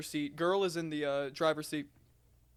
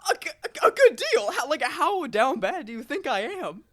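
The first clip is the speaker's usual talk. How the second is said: much higher and louder outraged disbelief; indignant disbelief; 9 semitones higher, 10 dB louder than usual. Retold or imitated. Cashier protests to coworkers, high loud indignant disbelief. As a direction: squawk it back, offended. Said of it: "I'm like".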